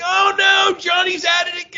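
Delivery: high, loud exasperated cry; mock alarmed shout. Mom and sisters finding the cats wrapped, high mock shout. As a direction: yell it like mom catching the kid again. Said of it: "my mom and sisters would be like".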